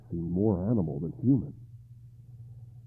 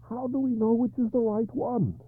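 higher, pleading king in despair; 4 dB louder than usual. Acted. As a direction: ask with rising despair. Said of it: "he asked in despair".

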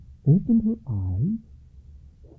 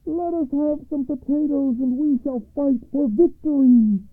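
high rallying call; son crying out; 5 dB louder than usual. A narrator's reading. Youngest son asking his mother for potatoes, high calling voice. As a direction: call it out high, over a wall. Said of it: "cried the youngest son over the west wall".

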